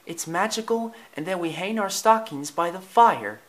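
high-voiced wonder; enchanted Lady. Lady describes hanging stockings, high and enchanted. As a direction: share the magic in a high, lilting voice.

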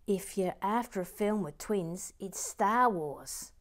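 put-on reading aloud in a shaky-confidence Australian accent; attempted Australian accent. Host reading new member Michael's message, attempted Australian accent. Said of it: "attempt an Australian accent".

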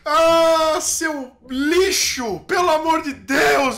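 loud, high cry of outrage; drawn-out, furious protest; exasperated outburst. Acted. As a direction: explode at an infuriating guest. Fed-up host insults his guest.

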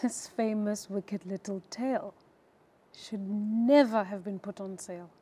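the final take combining pause, smirk and emotion; full annotated reading. Shown as a demonstration. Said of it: "you get all those little things in one".

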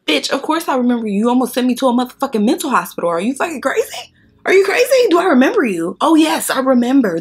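climbing to a high, loud outraged yell; rising rant. Real, not acted. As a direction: blow up at him in disbelief. Ranting at ex, voice climbing.

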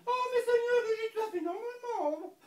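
high, laughing woman's voice; a high, gushing vet reassuring the owner; vet's voice. Retold or imitated. The vet reassuring the lion's owner, high and laughing. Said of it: "La vétérinaire a dit".

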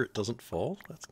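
normal talking voice

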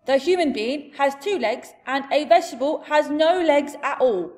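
big, emphatic giant voice; indignant giant. Acted. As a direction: lecture her as an indignant giant. Giant argues with Sophie.

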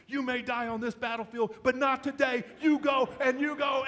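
rally him like a general before battle; rousing pep talk. Retold or imitated. Wife rallying her husband back in, battle cry. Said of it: "her pep talk".

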